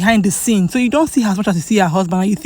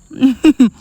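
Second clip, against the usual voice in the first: short, higher-pitched chuckle; amused chuckle; 4 semitones higher, 4 dB louder than usual. Real, not acted. Vlogger amused at the repeated vow, short chuckle. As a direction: chuckle lightly in a quick run.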